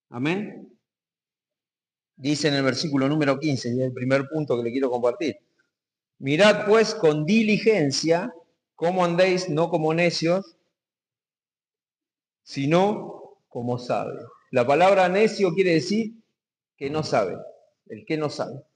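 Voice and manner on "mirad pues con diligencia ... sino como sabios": slow scripture reading. Acted. Pastor reading verse slowly, solemnly.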